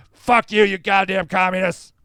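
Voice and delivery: hammed-up furious shout; angry Dan. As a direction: yell it as the ranting man at his peak. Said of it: "why are you so angry?".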